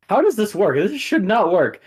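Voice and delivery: high, loud baffled player; exasperated imitation. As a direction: mimic someone who can't believe it. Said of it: "Steven's just like".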